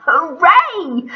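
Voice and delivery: loud high hooray; joyful cheer. Acted. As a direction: cheer with delight. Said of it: "said Sydney, Susie and Stanley".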